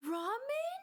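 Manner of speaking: put-on high, hopeful little-kid question; eager kid asking. Little kid asking the cashier for ramen, high and hopeful. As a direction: squeak it out like a hopeful child. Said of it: "I was like".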